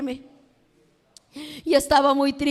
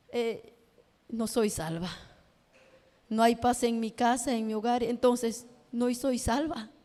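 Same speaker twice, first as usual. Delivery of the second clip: low, soft and slowing at the end; retold inner voice, sunk low; dejected self-talk; 4 semitones lower, 8 dB quieter than usual. Telling herself she is not saved, dejected and hushed. Retelling her despairing words to herself.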